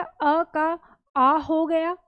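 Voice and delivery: अ का आ said slowly, stretching आ; teacher stressing the vowel change; slow emphatic. Shown as a demonstration. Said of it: "क्या हो गया".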